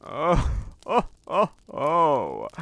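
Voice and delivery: oh, oh, through a laugh; amused, knowing laughter; chuckling. Acted. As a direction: laugh at a private joke. Laughing at eclectic bunch remark.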